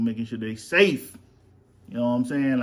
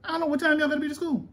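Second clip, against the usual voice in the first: playful high voice; much faster than usual. Pretend.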